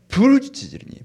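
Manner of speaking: high, loud, drawn-out cry. Acted. Preacher quoting the psalmist crying to God, a high loud cry. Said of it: "내가 부르짖는 거예요".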